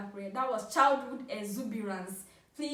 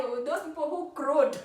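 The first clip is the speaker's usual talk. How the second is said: ordinary delivery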